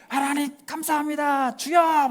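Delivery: high, ecstatic praying cry; mock thankful cry. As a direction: cry out thanks to God in rapture. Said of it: "막 이렇게 하지 않고".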